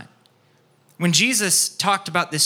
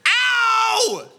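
high, loud pained cry of ow; mock pained childish wail; childhood scream; 3 dB louder than usual. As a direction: yelp like a hurt little kid. Kid hit by dirt clod screaming.

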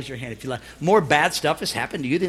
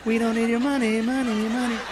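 chuckling through a singsong chant; laughing singsong. Shown as a demonstration. Preacher teasing the congregation about money, laughing. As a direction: tease the crowd with a laughing sing-along.

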